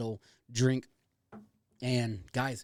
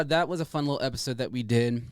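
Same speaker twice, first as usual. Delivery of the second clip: ordinary talk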